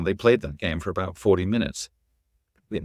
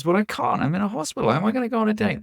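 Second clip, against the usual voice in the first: imitating a kid's incredulous, high-pitched objection; protesting kid; 13 semitones higher, faster than usual. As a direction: object in a much higher kid's voice. Acted kid protesting to the clown.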